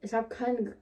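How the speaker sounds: ordinary talk, nothing marked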